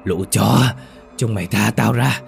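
possessed woman's snarled threats; furious cursing. A narrator's reading. Tied-up woman cursing the men restraining her, enraged snarl. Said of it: "miệng liên tục chửi rủa, đày nghiến mấy người".

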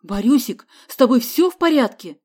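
loud, alarmed question; worried shout. A narrator's reading. Father finds son taped up, shouting in worry. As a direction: call out anxiously, voice rising. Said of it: "закричал Лаврентий Самсонович".

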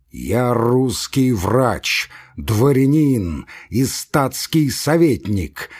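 very slow, word by word; pompous, spacing out each title; measured. Acted. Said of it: "сказал с расстановкой".

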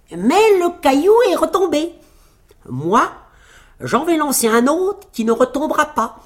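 high, loud little tailor; petit tailleur fanfaron. A narrator's reading. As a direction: outdo the giant with a high-pitched boast. (fanfaronnade d'une voix perchée)

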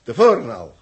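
loud, high burst of alarm; agitated outburst. Acted. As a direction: blurt it out, shaken. Revealing sabotage, agitated.

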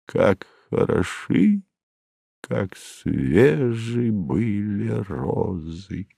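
soft, very drawn-out hint of old age; old man's dreary whisper. A narrator's reading. Narrator echoes a dreary whisper heard indoors, lightly aged and hushed. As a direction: let it waver slowly and fade, lightly aged. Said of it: "скучный старческий шёпот".

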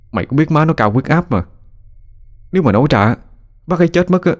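mildly fretful, pitch climbing as he explains; anxious protest. Acted. Dreading phone call to friend's mother.

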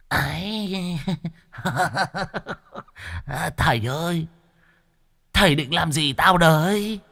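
high, wheedling ghost voice; pleading ghost. Acted. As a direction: fawn and beg nervously. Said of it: "Nó rú lên". (vong cao giọng nài nỉ)

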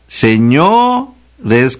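señores with a high, stretched O; exaggerated stress on O. Shown as a demonstration. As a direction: overdo the accent on the o of señores. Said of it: "con acento en la O de señores". (señores, con la o alargada y muy acentuada)

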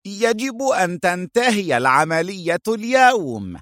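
angry outburst. A narrator's reading. Short boss ordering his men to finish tonight, angrily. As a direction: give orders in a high, cross voice. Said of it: "وصاح غاضبا".

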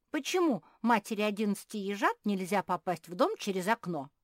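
loud, puzzled question starting high; surprised. A narrator's reading. Little squirrel asks why windows are forbidden, surprised. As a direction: ask it in wide-eyed wonder, loudly. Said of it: "удивился Бельчонок".

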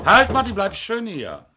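commanding shout. Acted. Inspector stopping a fleeing Marty, loud commanding shout. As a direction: stop him cold with your voice. Suspect tries to flee.

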